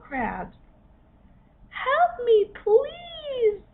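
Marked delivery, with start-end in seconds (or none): 1.7-3.6 high, loud pleading cry; little crab crying out. A narrator's reading. Trapped hermit crab begging as the seagull nears. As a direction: beg high and loud for rescue. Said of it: "cried the little hermit crab".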